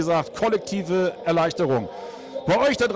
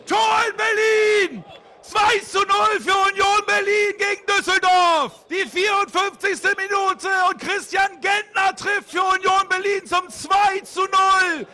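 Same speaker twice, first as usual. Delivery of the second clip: ecstatic goal shout; 10 semitones higher than usual. Real, not acted. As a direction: yell it in disbelief. Live radio goal call.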